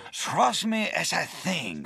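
light mock Spanish accent, high pitch. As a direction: imitate a Spanish director, lightly. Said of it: "impersonating my strong Spanish accent".

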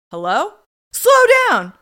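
hammed-up loud, high one-sided phone call; mock bad actor on a call; bad phone acting. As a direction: overact each reply, yelling into the phone. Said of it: "is not the best at phone acting".

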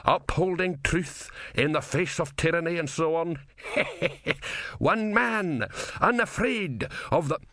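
high, gleeful character voice with a chuckle; pleased self-congratulation, pitched well up; happy. A narrator's reading. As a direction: revel in your own heroism, laughing midway. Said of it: "murmured Didactylos happily".

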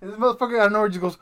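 ordinary talk, nothing marked

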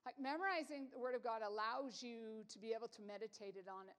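ordinary talk, nothing marked

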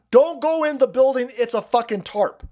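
high, loud imitation of a megaphone warning; mock yell into a megaphone; retold scream. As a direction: call it out as if warning a crowd. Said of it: "he's got a megaphone and he's screaming".

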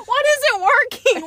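high, loud baffled question; retold insistent questioning. Husband keeps asking sleep-talking wife, loud mock bewilderment. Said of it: "He just kept saying".

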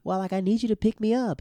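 put-on girly phone request; imitated girlfriend. Girlfriend's request, acted second take.

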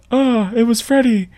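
high breathy-free mock shock; lightly voiced Nancy. Retelling Nancy telling her parents, high mock voice. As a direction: say it like a dazed heroine. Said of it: "she's like".

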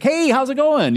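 a bright, over-eager hi to a passerby; cheery stranger greeting. Retold or imitated.

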